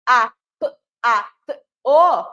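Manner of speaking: teacher sounding out the phonemes of a word; isolated sounds. Shown as a demonstration. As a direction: sound out the phonemes one by one, stressed. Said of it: "tem seis sons, olha só".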